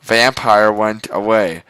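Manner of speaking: ordinary delivery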